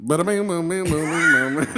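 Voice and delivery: put-on playful Chicago mobster accent, a Tommy from Casino bit; mock Chicago accent. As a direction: rattle it off like a Chicago mobster in a movie. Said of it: "It's fucking classic Chicago".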